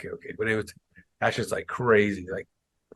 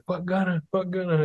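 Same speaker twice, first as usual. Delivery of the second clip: mock distant heckle; 8 semitones higher than usual. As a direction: imitate heckling from far away without volume. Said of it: "like, far away".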